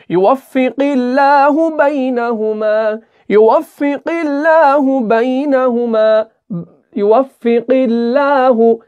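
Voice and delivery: slow chanted "युवफ़िक़िल्लाहु बैनहुमा" with a light, thin लाहु; thin lam recitation. Shown as a demonstration. Teacher repeats the verse so students copy it, slow chant. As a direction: recite slowly, never full-mouthed on "लाहु". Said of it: "लाहु बारीक पढ़ना है".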